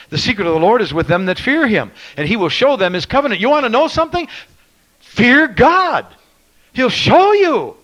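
high, loud climactic preaching; preaching at full force. Acted. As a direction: cry it out to the church.

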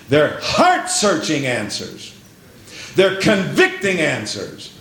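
slow, weighty sermon build, rising in pitch; emphatic exhortation, stretching the key words; earnest preaching. Real, not acted. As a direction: drive each point home like a preacher. Preacher returns to exhorting congregation.

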